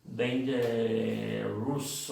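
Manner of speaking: slow "dangerous" ending in a wrong, full "-roos"; wrong-example "dangerous", Spanish-style vowels; mispronounced dangerous. Shown as a demonstration. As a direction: mimic a learner dragging "dangerous" into "dan-ye-roos". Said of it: "Mal, mal pronunciado eso".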